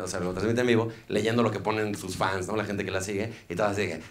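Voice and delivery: usual speaking voice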